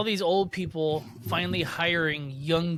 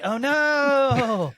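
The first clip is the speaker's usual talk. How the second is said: mock dread; 8 semitones higher, 6 dB louder than usual. Acted reaction to being named.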